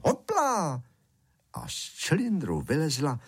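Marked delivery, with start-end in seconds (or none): 0.0-0.8 high-pitched excited call; shouted magic word. A narrator's reading. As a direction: cry it out, pitch leaping way up. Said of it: "A zakřičel".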